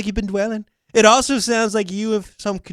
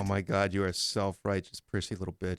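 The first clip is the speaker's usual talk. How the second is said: mock low mutter; 16 dB quieter than usual. Mock reply to the comment.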